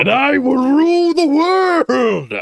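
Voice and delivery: high, loud Plankton villain shout; megalomaniac rant. Pretend. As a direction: shriek world domination like Plankton. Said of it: "now that sounds more like".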